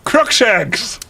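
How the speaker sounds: high, hammed-up cry; shouted spell. Heroine casting a curse at Voldemort, mock shout. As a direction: call it out like a melodramatic curse. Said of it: "I shouted".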